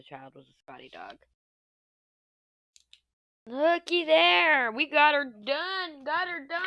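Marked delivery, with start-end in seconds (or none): 3.5-6.7 high, loud, drawn-out cheer; crowing over a finished job; excited cheer. Real, not acted. Proud finish of diamond painting.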